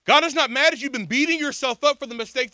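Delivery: ringing sermon declaration; preaching proclamation. Acted. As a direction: proclaim it to the crowd. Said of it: "I'm here to tell somebody today".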